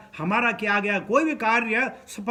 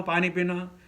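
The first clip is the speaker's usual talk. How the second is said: ordinary talk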